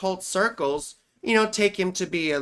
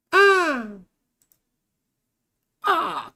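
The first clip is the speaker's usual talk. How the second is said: high sharp yelps, louder; sudden cries; 10 semitones higher, 4 dB louder than usual. Real, not acted. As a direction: blurt out a high yelp out of nowhere. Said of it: "I don't know why I'm doing that".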